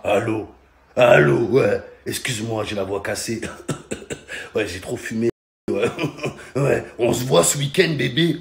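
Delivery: low, husky caller impression; Darth Vader voice. Girl on the phone with a deep Darth Vader voice. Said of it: "elle parlait comme ça. / la voix de Darvador".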